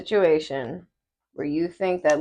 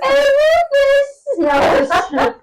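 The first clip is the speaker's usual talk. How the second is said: very high, slow posh squeal; hammed-up British lady; British accent; 8 dB louder, much slower than usual.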